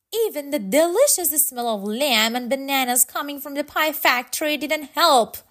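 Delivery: plain reading voice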